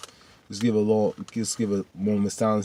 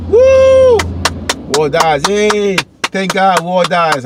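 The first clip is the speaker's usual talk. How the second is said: loud high whoop of joy; celebrate, loud and high; cheering; 9 semitones higher, 11 dB louder, much slower than usual. Real, not acted. Fan celebrating villain's death, loud joyful cheer.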